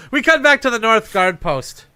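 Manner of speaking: projected improv narration; scene announcer. Acted. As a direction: announce the scene change grandly.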